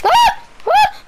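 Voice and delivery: shrill, very high, loud shriek of fright; terrified scream. Real, not acted. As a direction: scream at the jump scare. Jump scare in horror game.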